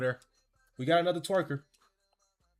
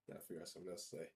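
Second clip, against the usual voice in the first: muttered aside; 13 semitones lower, 20 dB quieter, much faster than usual. Real, not acted. Trailing off to himself.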